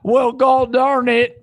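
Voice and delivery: hammed-up country yokel; mock Iowa farmer. Streamer mocking how Iowans supposedly talk, slow high yokel voice. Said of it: "a stereotype of Iowa is like".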